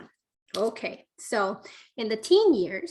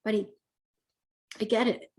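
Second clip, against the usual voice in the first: gentle understanding tone; faster than usual. Shown as a demonstration. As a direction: reassure him like you're on his side. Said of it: "Very different tone than going".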